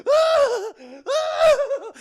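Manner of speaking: high, loud mock screams, half laughing; mock screaming. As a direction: ham up the terrified scream. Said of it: "just screaming".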